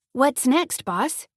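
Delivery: put-on bright high girlish voice, lifting on what's next; high-pitched voice. A narrator's reading. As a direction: pop the question up high and eager. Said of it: "Flora's high-pitched voice".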